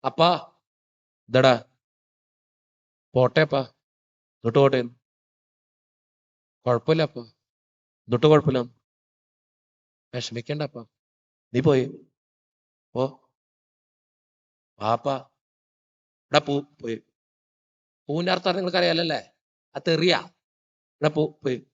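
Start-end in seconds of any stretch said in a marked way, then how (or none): 0.1-17.0 low voice with long pauses between short phrases; hushed, low imitation of men at a funeral; acted father-son exchange. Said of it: "അപ്പൊ ഞാൻ അടുത്ത് പോയിട്ട് ഇതിങ്ങനെ അപ്പനെ തൊട്ടിട്ട്". 21.0-21.7 low, soft, terse dismissal; subdued mock rebuff, pitched well down; imitated stoic father. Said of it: "എന്റെ തന്ത അങ്ങനെയാ".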